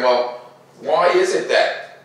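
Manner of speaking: ordinary delivery, nothing marked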